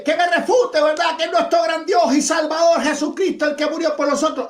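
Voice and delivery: high, loud mimicked challenge; mock shouting. Debater imitating his opponent's challenge about Jesucristo, mock shouting.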